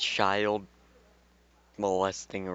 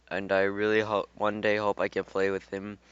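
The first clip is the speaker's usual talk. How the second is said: ordinary delivery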